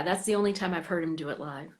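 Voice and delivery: ordinary delivery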